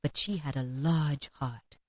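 slow, low, soft spirit voice; hushed, measured ghost; solemn ghost. A narrator's reading. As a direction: weigh it gently, low and unhurried. Ghost speaks solemnly to Scrooge.

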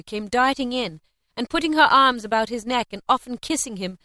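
usual speaking voice

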